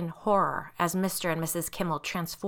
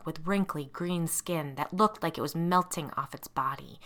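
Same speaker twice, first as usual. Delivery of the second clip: ordinary delivery, nothing marked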